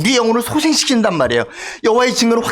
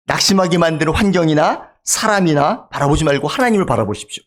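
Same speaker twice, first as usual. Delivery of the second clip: ordinary talk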